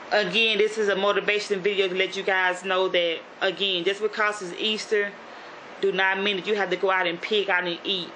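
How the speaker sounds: ordinary delivery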